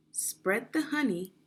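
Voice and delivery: ordinary talk, nothing marked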